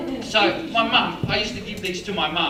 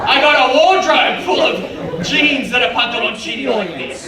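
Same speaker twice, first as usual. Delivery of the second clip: louder, higher exasperated rant; 5 semitones higher, 7 dB louder than usual. Real, not acted. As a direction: blast it out in mock outrage. Ranting about wardrobe of jeans.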